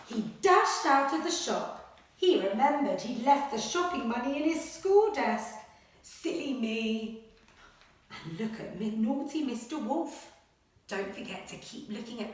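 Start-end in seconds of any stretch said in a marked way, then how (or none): 0.1-7.2 lively, louder storybook reading; animated picture-book read-aloud; reading to children. Acted. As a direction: read it like a bedtime adventure.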